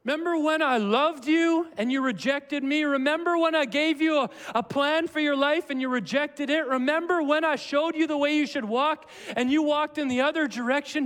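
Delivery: high, forceful, relentless reminding; impassioned messenger's reproach. Acted. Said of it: "This is a messenger coming and saying".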